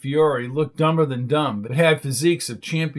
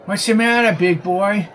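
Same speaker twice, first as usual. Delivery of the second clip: put-on lightly slurred drunk, higher; town drunk; 5 semitones higher, 4 dB louder than usual. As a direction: slur it like a tipsy guy. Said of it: "slurring his speech".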